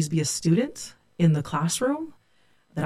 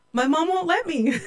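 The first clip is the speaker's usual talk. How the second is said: high, laughing through the excuse; sheepish laugh through the words; 13 semitones higher than usual. Retold or imitated. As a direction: laugh at your own excuse, much higher. Retelling an awkward excuse to mentor.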